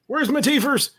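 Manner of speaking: squeaky high toothless whine; high old man voice. Shown as a demonstration. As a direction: go way up and lose the teeth.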